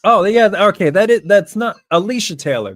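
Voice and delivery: excited recognition. Real, not acted. Fan spotting the ring announcer, excited and loud. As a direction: blurt it out as it clicks. Sudden recognition watching the show.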